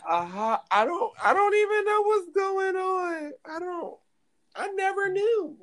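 very high, drawn-out denial; acted innocent denial. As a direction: plead ignorance slowly in a squeaky high pitch. Acting out feigned ignorance.

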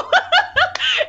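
high-pitched burst of real laughter; amused laugh. Real, not acted. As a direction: laugh out loud at being caught out. Laughs at daughter's retort.

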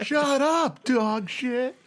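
high, drawn-out imitation of a bar shouting; hammed-up sitcom chorus; mock crowd yell. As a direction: mimic a far-off crowd yell without real volume. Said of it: "turns around and yells".

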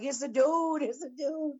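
high-pitched urgent imitation; imitated high, hurried tip-off; mock urgent aside. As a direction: repeat it high and insistent, mimicking her. Mimics girl's alarmed remark.

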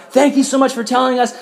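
high-pitched acted thanks; grateful gushing. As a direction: pitch it up like a delighted, simple hermit. Hermits thanking the priest.

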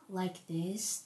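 low, very soft podcast voice; peaceful podcast voice. Shown as a demonstration. Podcaster demonstrating how others normally talk, peaceful and hushed. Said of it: "their voice is very peaceful".

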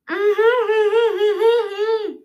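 laughing through taped lips; muffled laugh. Real, not acted. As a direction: laugh with mouth sealed shut.

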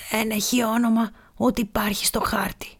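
put-on soft whispered answer of a dying old pirate. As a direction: breathe the answer out with no voice. (ψιθυριστά)

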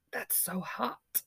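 slow breathless whisper. Acted. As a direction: whisper it slowly, swooning. Reading a swooning comment.

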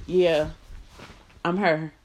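usual speaking voice

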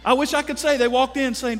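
ordinary talk

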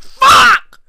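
high, loud outburst; furious shout. Real, not acted. Ranting vlogger blowing up at the goat story, furious shout. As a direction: explode with the word.

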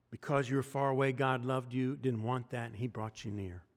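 ordinary talk

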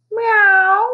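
high, loud drawn-out meow; a cat yowling down from the tree. A narrator's reading. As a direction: yowl like a cat. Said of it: "it yowled".

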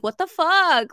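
loud, high "what the fuck" in disbelief; retold husband's shocked yell; shocked outburst. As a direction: blurt it out, stunned and loud. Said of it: "And he'd be like".

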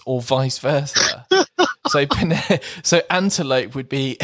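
normal talking voice

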